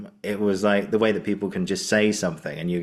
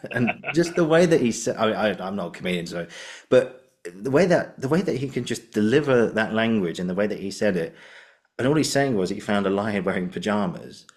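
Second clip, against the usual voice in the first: normal talking voice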